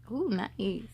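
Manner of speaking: ordinary delivery, nothing marked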